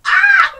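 short high shriek of frustration; exasperated shriek. Real, not acted. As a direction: let out a sharp, loud frustrated yelp. Outburst at the stupid book.

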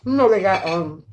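talking between bites; speaking around food; mouth full, chewing. Real, not acted. Seen 3: Bites wing before, chews with bulging cheeks while speaking.

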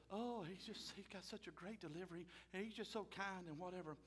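soft, breathy mock swoon over the preacher; swooning churchgoer. Mimicking a fan of slick preachers, soft swooning gush. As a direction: gush softly like a smitten fan. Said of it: "You want some slick talker".

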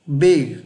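"big" blended quickly from b, i, g; English "big", final g kept; blended English word. Shown as a demonstration. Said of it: "Phát âm nhanh sẽ là".

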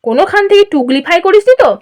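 high, indulgent back-patting tone; patronizing praise. Acted. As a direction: gush a little, then teasingly question. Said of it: "বেশ পিঠ চাপড়ানো সুরে বলল".

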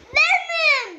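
loud, high, thrilled yell; excited shout. Real, not acted. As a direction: shout it out in triumph. Shouts the flavour after tasting.

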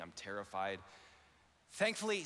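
ordinary delivery

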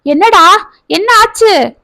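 high, urgent demand; urgent worry. Acted. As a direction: shake the answer out of her. Mother shaking daughter for answers.

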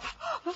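sudden high startled gasp; sharp gasp. Acted. Girl slipping off the wall, sharp high gasp. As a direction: gasp as the stone gives way. Stone moves under her.

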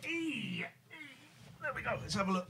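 high, soft coo to a pet; soft coaxing. Real, not acted. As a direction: hush and coax the cat.

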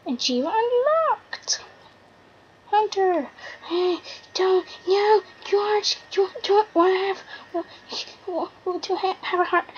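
high, shocked cries; can't believe it happened; real startled outburst; outraged shock. Real, not acted. Shocked outcry in game.

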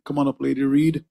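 usual speaking voice

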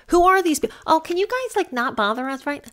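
high, prissy mock politeness; mocking polite request. Mocking Allie asking the photographers to leave, high and prissy. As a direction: play the timid, polite ask she made. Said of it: "You don't ask these people politely".

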